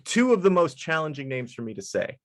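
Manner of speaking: usual speaking voice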